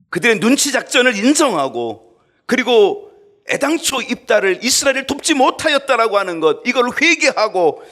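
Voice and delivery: loud, high preaching call to repent; fiery preaching. Acted. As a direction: press the congregation hard. Preaching at full force.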